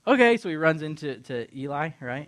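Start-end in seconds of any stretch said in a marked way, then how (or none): 0.1-0.4 high, loud chirp; bright loud boyish reply; eager young Samuel. Retold or imitated. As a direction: answer instantly like an obedient kid. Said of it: "And he's like".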